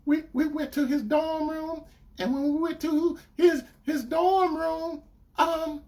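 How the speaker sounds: put-on high, stumbling girlfriend voice; retold girlfriend. Girlfriend admitting it happened, imitated hesitantly. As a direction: imitate her hedging toward the admission. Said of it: "So she said".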